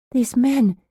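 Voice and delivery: worried and urgent; alarmed appeal. A narrator's reading. Patient appealing to nurse, alarmed.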